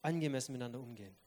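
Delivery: soft, calm firm voice. Retold or imitated. A family member refusing to shout, calm and soft. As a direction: set the boundary quietly and calmly. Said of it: "der sagt".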